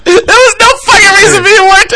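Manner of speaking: high, loud, laughing through the words; laughing protest. Real, not acted. Host laughing at his co-host for sneaking in a shoutout. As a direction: protest while cracking up.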